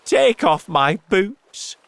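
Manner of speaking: high, loud, drawn-out order; shouted demand. A narrator's reading. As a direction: shout it urgently. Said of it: "shouted the centipede".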